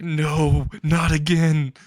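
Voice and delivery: retold loud protest. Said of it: "like, so loud".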